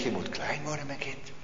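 a hushed fatherly answer; gentle voice of God. Retold or imitated. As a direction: speak it down to a small child. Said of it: "En hij zegt".

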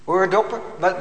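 ordinary talk, nothing marked

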